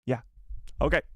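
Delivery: normal talking voice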